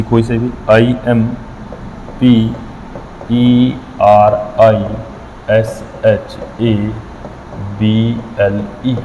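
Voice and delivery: आईएमपीईआरआईएसएचएबीएलई spelled out very slowly, one letter at a time. Shown as a demonstration. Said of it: "इसने एक word लिखा है". (आईएमपीईआरआईएसएचएबीएलई को एक-एक अक्षर धीरे-धीरे बोलें)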